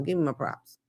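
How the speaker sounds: ordinary talk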